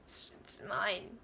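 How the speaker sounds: soft, slow whisper; a whispered it's mine; whispered possessive. Real, not acted. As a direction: hush it like a secret claim. Possessive aside about her ship.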